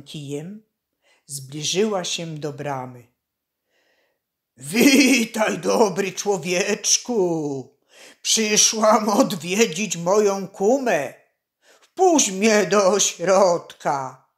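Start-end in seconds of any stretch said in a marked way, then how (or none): 4.6-14.3 loud, drawn-out hoarse crone voice; hoarse witch. A narrator's reading. Witch posing as poor peasant woman at the gate, hoarse and loud. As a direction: croak it out like a disguised old witch. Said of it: "powiedziała chrapliwym głosem".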